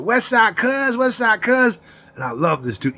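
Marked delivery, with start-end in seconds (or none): 0.0-1.8 high, loud, slurred friend greeting; homeboy's loud high greeting after a stroke; imitating stroke-hit friend. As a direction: imitate him calling out his old nickname. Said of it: "He said".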